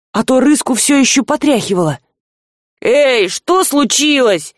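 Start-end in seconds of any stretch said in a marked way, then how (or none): none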